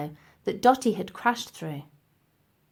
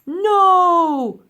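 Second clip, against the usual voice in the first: high, loud desperate plea; pleading; 9 semitones higher, 10 dB louder than usual. A narrator's reading. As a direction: beg loudly, high-pitched. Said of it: "pleaded the professor".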